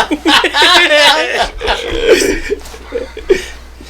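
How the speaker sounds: high rolling laugh; long laughter. Real, not acted. Laughing with friends after the hunting story.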